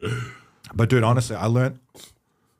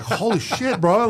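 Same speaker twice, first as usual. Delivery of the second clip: shocked; 9 semitones higher, slower than usual. Retold or imitated. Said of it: "and you're like".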